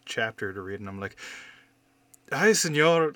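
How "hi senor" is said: loud, high imitation Mexican accent; child's overdone attempt at a Mexican accent. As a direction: ham up a bad Mexican accent. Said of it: "I try to actually do an accent".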